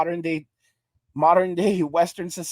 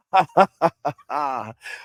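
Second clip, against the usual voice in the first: laughing; 5 semitones lower than usual. Real, not acted. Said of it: "laughs at own accent".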